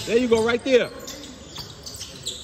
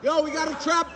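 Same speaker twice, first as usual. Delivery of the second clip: calling out; 5 semitones higher than usual. Real, not acted. Coach yelling to players on court.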